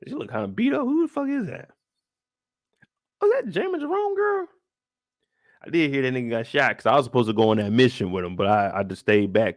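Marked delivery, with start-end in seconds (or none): 0.1-4.5 puzzled. Retold or imitated. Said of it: "I'm looking down like".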